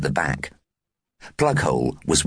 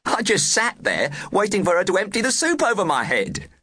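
put-on high boy voice. A narrator's reading. Plughole's retelling continues.

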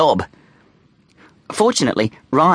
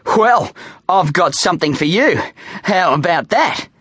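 raised, higher bully's challenge; trying tough; 5 semitones higher than usual. A narrator's reading. Frightened bully showing off his surprise, trying to sound tough. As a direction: push the voice up to seem tough. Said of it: "trying to sound tough".

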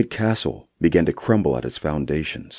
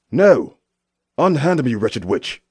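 high, loud desperate cry; shouting; 8 semitones higher, 4 dB louder, slower than usual. Acted. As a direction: shout in defiance. Knight cries out against witch.

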